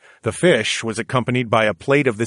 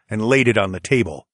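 ordinary delivery

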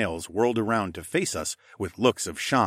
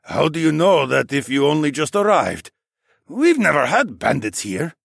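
higher, louder challenging man; skeptical councilman; 7 semitones higher, 7 dB louder than usual. Acted. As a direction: challenge the stranger's claim indignantly. Doubting councilman challenges the visitor.

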